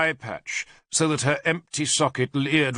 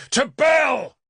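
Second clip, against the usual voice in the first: high, loud war cry; battle shout; 10 semitones higher, 5 dB louder than usual. A narrator's reading. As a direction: scream it as you strike. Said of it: "I shouted".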